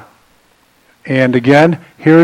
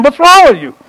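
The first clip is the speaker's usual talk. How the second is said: exasperated; 9 semitones higher, 3 dB louder than usual. Retold or imitated. Voicing God's exasperation.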